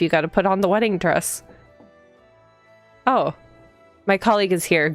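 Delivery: ordinary talk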